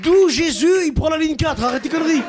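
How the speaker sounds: high, loud exasperated mock rant; hammed-up angry commuter; mock Parisian rant. Imitating an angry Parisian in the metro.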